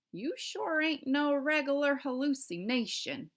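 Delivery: slow Southern cowboy drawl; Southern cowboy dialect. A narrator's reading. Cowboy telling Omri he is real, Southern drawl. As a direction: lean into a Southern cowboy accent. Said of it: "this is the cowboy's dialect".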